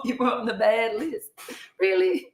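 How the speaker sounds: laughing, teasing question pitched high; laughing disbelief. Real, not acted. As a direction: tease her through a laugh, high and amused. Teasing disbelief at friend's confession.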